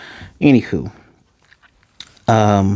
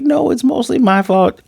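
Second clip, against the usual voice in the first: high sheepish voice; 13 semitones higher than usual. Retold or imitated. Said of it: "She's like".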